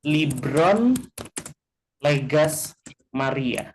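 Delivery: slow, careful reading of the reordered words; teacher demoing a flipped sentence slowly; slow example sentence. Shown as a demonstration. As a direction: read it slowly so students follow. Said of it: "balik kalimatnya seperti ini ya".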